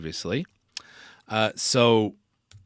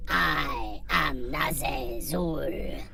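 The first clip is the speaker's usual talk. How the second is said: heavy pitch effect; 5 semitones higher, 4 dB quieter, much slower than usual. Shown as a demonstration. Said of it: "I can go right up here".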